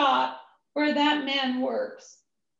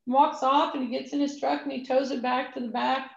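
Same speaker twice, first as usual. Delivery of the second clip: ordinary delivery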